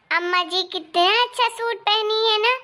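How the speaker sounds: ordinary talk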